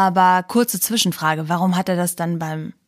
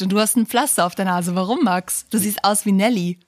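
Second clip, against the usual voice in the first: usual speaking voice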